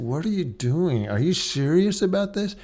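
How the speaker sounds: mildly exasperated retold scolding; a touch tight and testy; tight, irritated voice. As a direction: show irritation without yelling. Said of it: "get a little tight in voice".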